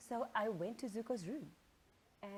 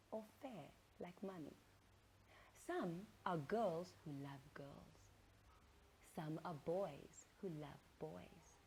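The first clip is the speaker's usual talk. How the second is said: tender mother; 3 semitones lower, 7 dB quieter than usual. Acted. Mother gently teaching son.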